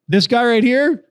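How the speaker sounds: loud, high-pitched accusing rant; mock public call-out, starting loud and high; retold tirade. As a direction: imitate a guy ranting into a bar mic. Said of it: "goes on his whole tirade".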